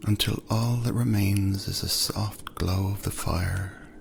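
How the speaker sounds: ordinary delivery, nothing marked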